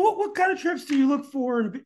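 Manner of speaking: ordinary talk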